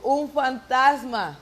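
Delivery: high, loud, slow cry of fright; terrified disciples shouting; frightened cry. Acted. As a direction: cry it out as if seeing a ghost. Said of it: "y gritaron de miedo".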